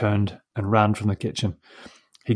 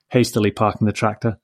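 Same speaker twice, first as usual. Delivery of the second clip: usual speaking voice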